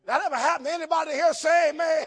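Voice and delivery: loud, fast call to the church. Acted. Preacher calling for amens, loud. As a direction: rouse the crowd.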